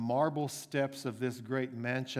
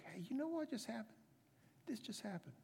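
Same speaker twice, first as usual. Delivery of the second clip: hushed gossip; 9 semitones higher, 12 dB quieter, faster than usual. Acted. Gardener gossips to the cook.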